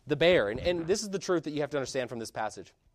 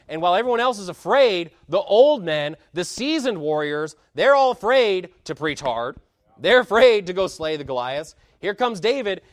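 preaching at full force; 4 semitones higher, 7 dB louder than usual. Acted. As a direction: rouse the church against fearful old men.

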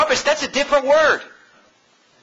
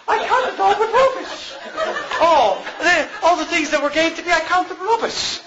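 high-pitched mock British voice; badly faked British accent, mocking the wording; hammed-up British accent, pitched way up; 8 semitones higher than usual. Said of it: "I'm not good at a British accent".

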